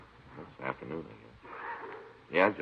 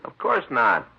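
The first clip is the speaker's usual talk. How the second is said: higher and a bit louder, quick protest; defensive denial; 7 semitones higher, 3 dB louder, slower than usual. Acted. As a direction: brush off the accusation indignantly. Partner asks if he did wrong.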